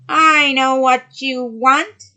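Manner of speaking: high, loud, slow witch voice; sea witch voice. Acted. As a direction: loom over her as the sea witch. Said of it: "said the sea witch".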